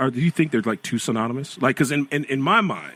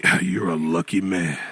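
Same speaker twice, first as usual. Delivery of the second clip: put-on low old-man compliment; 6 semitones lower than usual. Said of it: "They say".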